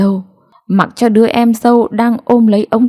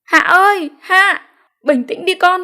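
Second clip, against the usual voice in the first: high-pitched calling over the river; calling across; 11 semitones higher than usual. A narrator's reading. Parents calling across the river to their weeping daughter, high and urgent. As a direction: call out to your daughter to calm down. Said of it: "chạy ra bờ sông gọi với sang".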